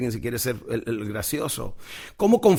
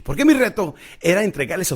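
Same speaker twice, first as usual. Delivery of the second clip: louder, lifting the voice up; raised voice; 6 semitones higher, 5 dB louder than usual. Shown as a demonstration. Speaker closing his talk, raising his voice to the audience. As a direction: push the voice up to build the close. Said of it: "nota cómo empiezo a trabajar la modulación de la voz un poquito más alta".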